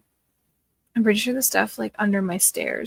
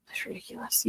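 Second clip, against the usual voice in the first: whispered disbelief; 10 dB quieter than usual. Real, not acted. Reacting to messy basement.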